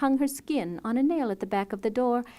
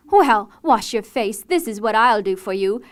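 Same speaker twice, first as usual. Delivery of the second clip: enraged cry; 12 dB louder than usual. A narrator's reading. Dorla driving the beggar away, very loud and furious. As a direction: scream it at him in fury. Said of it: "cried out in a rage".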